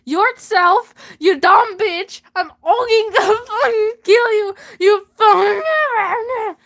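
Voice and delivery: angry woman ranting into a news mic, breaking into laughter. Acted. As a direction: scream at Weimar through the mic. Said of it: "An angry woman grabs the mic".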